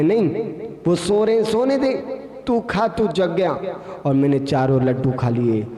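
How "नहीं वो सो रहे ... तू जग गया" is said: much higher, insistent demon voice; the dream demon overrules the friend's concern for his sleeping companions. Acted. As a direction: wave off his objection, urging him on. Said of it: "बोले".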